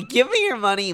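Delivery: high, loud mock demand for money; a playful high-pitched money demand. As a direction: ham up a greedy plea. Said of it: "I said".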